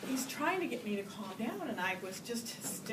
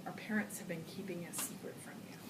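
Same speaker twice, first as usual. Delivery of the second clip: softer whispered secret; conspiratorial whisper; 3 semitones lower, 6 dB quieter, faster than usual. Retold or imitated. Big sister telling little Lisa a secret, in a whisper.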